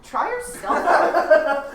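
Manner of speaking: slow, high and laughing; laughing drawn-out echo. Retold or imitated. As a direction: stretch it out while cracking up. Echoes line 220 mockingly.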